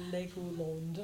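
Legoland said the French way, lightly imitated; a light French accent on Legoland, imitating the nephew. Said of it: "he said it in a French accent".

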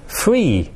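FREE drawn out and set apart; stress on FREE. Shown as a demonstration. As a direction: lean on the word "free", then pause. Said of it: "I did emphasise this word".

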